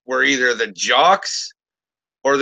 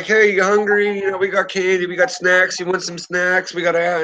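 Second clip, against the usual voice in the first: higher, gentle coaxing voice; coaxing a child; 6 semitones higher than usual. Retold or imitated. Narrator offers candy to the cop's crying daughter, higher and gentle. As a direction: sweetly tempt a crying kid with snacks.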